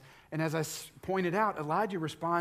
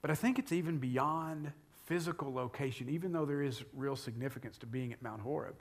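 usual speaking voice

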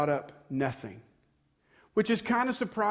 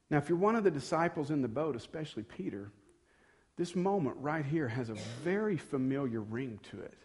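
ordinary delivery

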